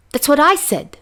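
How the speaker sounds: high, loud angry hiss; a sharp angry hiss through the teeth. A narrator's reading. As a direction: spit it out in anger. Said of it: "he hissed angrily".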